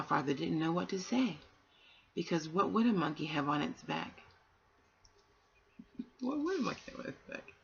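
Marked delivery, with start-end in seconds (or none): 6.2-7.5 much higher, softer and quick; amused musing. Real, not acted. Reader musing to the children, high and soft. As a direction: toss the question up lightly, half to yourself.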